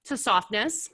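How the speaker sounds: talking through a mouthful of wings; chewing while speaking; mouth full. Real, not acted. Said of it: "Talking with my mouth full".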